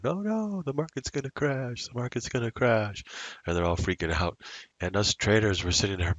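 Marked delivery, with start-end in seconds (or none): 0.0-3.1 high, whiny imitation of panicking people; mocking the panicked crowd, much higher. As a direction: ham up the scared crowd crying crash. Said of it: "they're all freaking out going".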